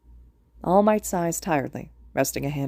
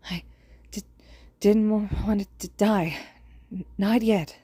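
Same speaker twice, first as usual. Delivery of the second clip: put-on dying boy voice; 4 semitones higher than usual. A narrator's reading. Dying student, stuttering fear.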